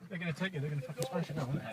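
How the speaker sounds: hushed aside. Real, not acted. Driver murmurs as window threatened.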